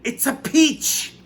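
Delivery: very high, slow, loud announcement; Aunt Spiker shouting about the peach; excited shout. A narrator's reading. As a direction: yell it up at the tree. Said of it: "Aunt Spiker was shouting".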